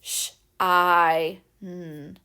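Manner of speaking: "Sh, I, n" segmented; sounding out. Shown as a demonstration. Teacher to kids, sounding out shine again. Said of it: "the vowel sound we hear".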